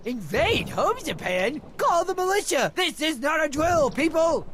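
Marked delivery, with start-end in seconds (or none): 1.8-4.5 alarmed. Acted. Character calls alarm.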